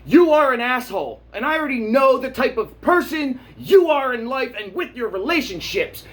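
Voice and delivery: worked-up rant at the anti-maskers; heated rant. Real, not acted. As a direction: tell them off, all fired up.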